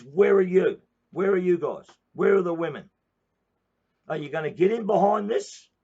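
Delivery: loud, high, hammering call to arms; rally the women, demanding an answer; rallying shout. Real, not acted.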